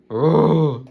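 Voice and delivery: loud playful dinosaur roar. Shown as a demonstration. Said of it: "Yeah, do it nicely then".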